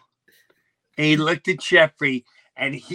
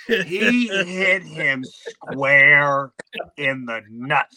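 ordinary delivery

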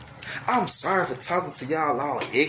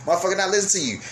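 normal talking voice

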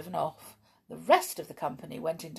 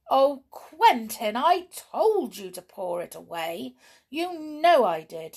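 high, slow, exasperated wifely protest; distressed; 6 semitones higher, 7 dB louder than usual. A narrator's reading. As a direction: wail at the husband in dismay.

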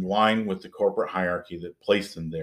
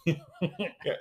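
normal talking voice

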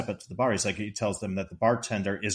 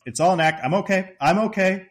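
urgent reassurance; 8 semitones higher, 5 dB louder, faster than usual. Retold or imitated. Clark reassures family after blast.

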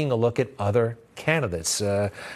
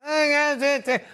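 high mock echo of Biden's line. Host mocking Biden's speech like a bad coach. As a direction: parody the speech, cut off. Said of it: "bad football coach".

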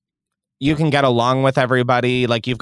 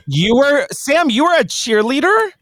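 surprised; 11 semitones higher, 4 dB louder than usual. Real, not acted. Surprised at cheerleader news.

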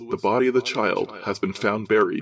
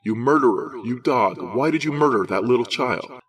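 louder, furious accusing shout; roaring accusation; 4 dB louder than usual. A narrator's reading. District attorney raging at Lewis, louder furious shout. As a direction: hurl each accusation at him. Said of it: "roared McElry".

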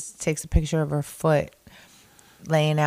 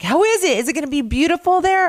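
much higher, quick and eager; 13 semitones higher, 4 dB louder, faster than usual. Retold or imitated. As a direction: ask like an excited friend. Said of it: "she's like".

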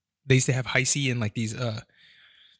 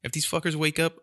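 usual speaking voice

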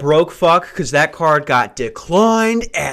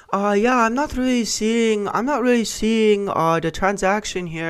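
support lady; 8 semitones higher than usual. Retold or imitated. Support lady on the phone not finding the charge, mildly hesitant. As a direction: sound unsure on a support call. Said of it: "She's like".

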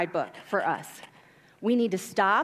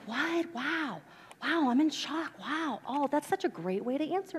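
high, soft, awed gasps; amazed hushed wow; 4 semitones higher, 7 dB quieter than usual. Retold or imitated. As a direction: breathe out amazement. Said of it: "they were like".

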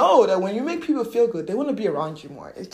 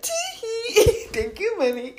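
high, giggly tee-hee; flirty thanks through a giggle; coy giggle; 13 semitones higher, 4 dB louder than usual. Acted. Winking back at money as secret friend, coy giggle.

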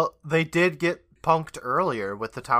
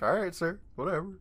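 higher, offhand giving-in voice; mock compliance; 6 semitones higher than usual. Co-host imagines obeying the hat guy, mock-compliant. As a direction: give in lightly, pitched up. I'd be like.

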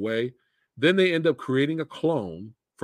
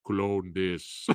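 Arnold voice; 3 semitones lower, 6 dB quieter than usual. Retold or imitated. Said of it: "he says".

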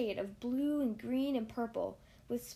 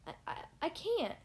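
stammering; 5 semitones higher than usual. A narrator's reading. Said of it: "started to say".

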